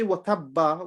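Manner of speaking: "tabba" with a full "ba" at the end; shadda fully read. Shown as a demonstration. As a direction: sound out the final vowel of "tabba". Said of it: "we are reading the shadda".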